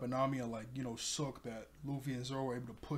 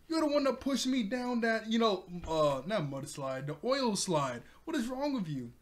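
higher, louder indignant outburst at Nami; mad Zoro; 7 semitones higher, 3 dB louder than usual. Retold or imitated. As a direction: protest like you were shoved down a slide. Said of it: "Obviously, Zoro is mad".

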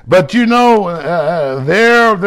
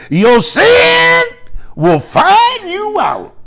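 much higher, slow, drawn-out preacher voice; elder preaching; 14 semitones higher, much slower than usual. Retold or imitated. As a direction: ring it out like the old elder from the pulpit. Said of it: "he used to say".

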